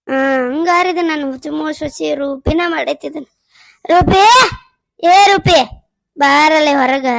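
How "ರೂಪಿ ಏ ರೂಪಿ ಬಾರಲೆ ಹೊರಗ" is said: loud shouted call; mother-in-law shouting for Roopa to come out. Acted. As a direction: bellow her name across the yard.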